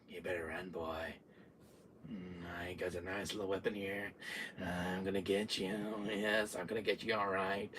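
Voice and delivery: low, soft villain murmur; quiet menace, toying with prey; creepy threat. Retold or imitated. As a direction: croon the threat low and hushed. Villain threatens fleeing player.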